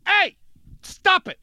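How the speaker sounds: high, sharp acted commands; mock warding-off cries. Man showing how to react to danger, high mock alarm. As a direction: call out as if fending someone off.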